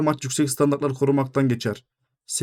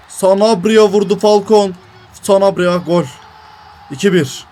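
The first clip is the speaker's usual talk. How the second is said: slow, drawn-out louder shout of the goal; goal call; 4 semitones higher, 8 dB louder than usual. Real, not acted. Gamer shouting as his striker scores. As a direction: belt out the scorer's name.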